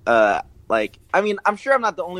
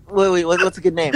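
ordinary talk

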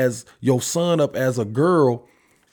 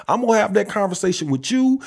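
usual speaking voice

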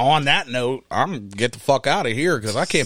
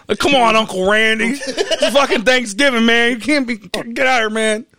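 mock scolding relative; 8 semitones higher, 4 dB louder than usual. Imagined Thanksgiving talk to uncle.

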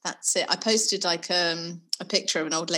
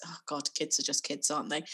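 quiet; 5 semitones lower, 7 dB quieter than usual. Real, not acted. Resigned aside.

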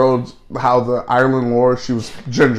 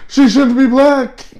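high, slow whiny imitation of an objector; mock complaining coworker; 11 semitones higher, 4 dB louder, slower than usual. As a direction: mimic her complaint way up high, dragged out.

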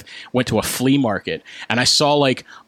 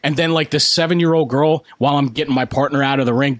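normal talking voice

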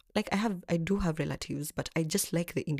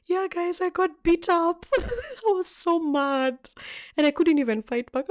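excited retelling; 12 semitones higher, 5 dB louder, slower than usual. Real, not acted. Laughing, angry retelling of beating.

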